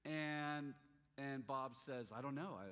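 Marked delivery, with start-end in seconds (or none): 2.2-2.7 imitated hesitant stammer, a little loud. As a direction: stumble over the words like Bob. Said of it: "he starts stammering".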